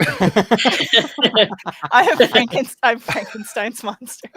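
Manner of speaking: laughing. Acted. Laughing mock monster claim.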